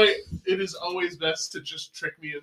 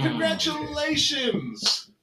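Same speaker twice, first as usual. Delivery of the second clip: mock congratulations; 10 semitones higher, much slower than usual.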